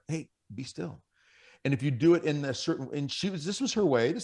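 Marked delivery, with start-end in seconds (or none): 0.1-1.0 soft and calm; calm, settling tone. Shown as a demonstration. Husband coming home calms the family, soft and calm. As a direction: settle the whole house with two quiet words. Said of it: "you can set that tone by simply saying".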